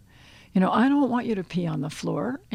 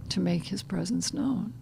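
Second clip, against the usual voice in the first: usual speaking voice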